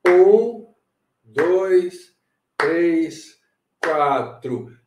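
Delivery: slow, evenly spaced count-in. Shown as a demonstration. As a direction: count the pulse in, falling from loud to normal. Counting in the pulse.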